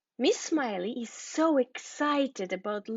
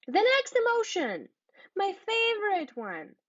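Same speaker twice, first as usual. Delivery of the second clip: much higher, bright teacher excitement; excited; 10 semitones higher than usual. Real, not acted. Teacher introducing her favourite emotion to the class, excited and higher. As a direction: lift it up, she loves this one.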